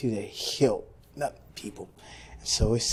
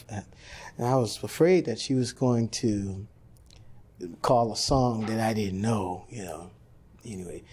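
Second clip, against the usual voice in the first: ordinary delivery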